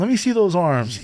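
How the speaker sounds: high, playful gym-bro voice; hammed-up gym bro showing off; mock gym bro. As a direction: tease him like a gym buddy. Bro banter, mock challenge.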